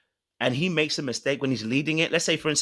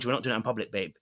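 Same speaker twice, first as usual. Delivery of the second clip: hushed and firm, keeping it private; quiet firm aside; 4 semitones lower, 6 dB quieter, faster than usual. Retold or imitated. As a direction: pull her aside and say it low. Husband quietly correcting wife.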